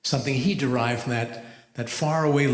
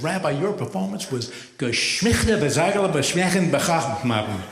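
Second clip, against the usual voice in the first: phlegmy fake Yiddish gibberish, pitched up; guttural mock Yiddish compliment; 3 semitones higher than usual. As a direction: spit out throaty made-up Yiddish praise. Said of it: "he used a lot of phlegm".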